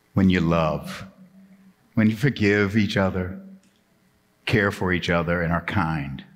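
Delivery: low, slow, hushed preaching with long pauses; solemn sermon close. Real, not acted. Preacher assuring the congregation God has their back, solemn and low. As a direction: bring the lesson home gently, deep and unhurried.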